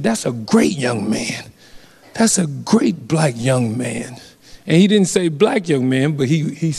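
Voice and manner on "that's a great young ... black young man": a lightly voiced old man, slow and lower, a little softer; mild imitation of an old man's praise, slowed down; old officer quote. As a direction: ease into a hint of an elderly retired cop, slow and low. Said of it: "and he said".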